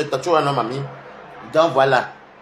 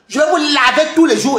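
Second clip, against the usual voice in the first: raised, higher and louder on tous; snapping a threat, pitch jumping up; angry threat; 8 semitones higher, 4 dB louder than usual. Real, not acted. Streamer threatening rivals who say his name, loud and angry.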